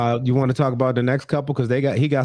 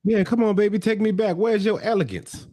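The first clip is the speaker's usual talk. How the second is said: mock pleading boyfriend; 10 semitones higher than usual.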